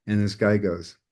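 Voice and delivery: usual speaking voice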